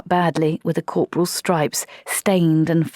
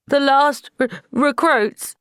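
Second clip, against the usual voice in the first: stammering; 9 semitones higher, 4 dB louder, slower than usual. Acted. Wazza stammers the grim realisation.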